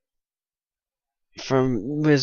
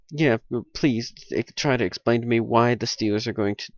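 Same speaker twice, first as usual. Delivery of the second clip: usual speaking voice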